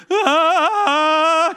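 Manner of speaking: loud high gleeful yell; thrilled kid's wind-in-face whoop; joyful scream. Retold or imitated. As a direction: shout with joy out the sunroof. Son shouting from sunroof.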